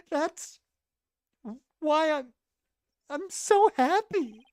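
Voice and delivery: high, loud happy sobbing; crying. Acted. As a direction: sob through the words. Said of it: "Sounds like she's crying back there".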